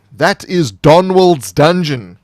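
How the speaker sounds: slow, loud, grand title reveal; dramatic title announcement. Acted. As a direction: boom out the dungeon's name like a trailer.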